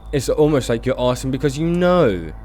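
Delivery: ordinary talk, nothing marked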